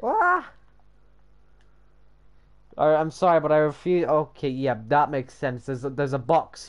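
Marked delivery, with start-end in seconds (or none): 0.0-0.5 short, very high "ah"; startled yelp. Real, not acted. Something happens mid-build, high yelp. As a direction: yelp suddenly, far above your speaking pitch.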